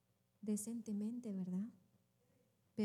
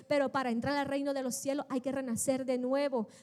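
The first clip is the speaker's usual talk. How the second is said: ordinary talk, nothing marked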